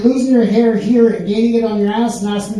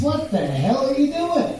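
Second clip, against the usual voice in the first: hammed-up astonishment, pitched up; incredulous question; 5 semitones higher, 5 dB quieter than usual. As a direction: ask it like a baffled joke character. Alligator confronts iguana in the joke.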